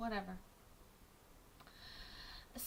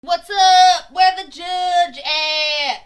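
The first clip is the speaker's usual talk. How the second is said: loud, higher yelling copy of the defendant; defendant impression; 7 semitones higher, 20 dB louder, much slower than usual. Streamer mimicking the defendant from the clip, loud and high. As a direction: belt it out like the guy in the clip.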